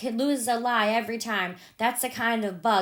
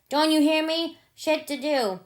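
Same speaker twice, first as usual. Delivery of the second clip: raised, higher shouted command; mighty loud order; 6 semitones higher than usual. Retold or imitated. Jim to his daughter, a loud angry repeated order. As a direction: bark it at the child who won't move. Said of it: "mighty loud".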